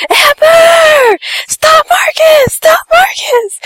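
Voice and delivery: very high, drawn-out imitated scream; mom's panicked scream. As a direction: shriek it like a frantic mother chasing a sled. Mom screaming while running downhill.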